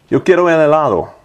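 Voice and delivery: "Yo quiero el helado" said as Spanish words, a bit quick and clearly spoken for dictation; clear Spanish phrase. Shown as a demonstration. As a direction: read "Yo quiero el helado" aloud in Spanish, crisply, to a phone mic. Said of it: "what I was saying in Spanish".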